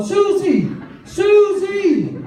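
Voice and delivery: high-pitched, carrying shout; shouting for lost dog. Acted. Owner searching for a lost dog, high calling shout. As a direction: holler the name across a distance.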